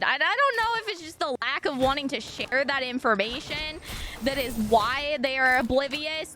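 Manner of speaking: ordinary talk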